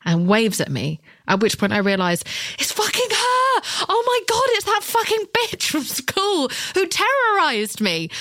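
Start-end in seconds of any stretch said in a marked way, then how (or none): 2.6-8.1 high, slow, panicked realisation; reliving the terror of seeing the school bully, high-pitched panic; panicked inner scream. Retold or imitated. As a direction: shriek it inside your head like pure dread. Retold panic at spotting the bully.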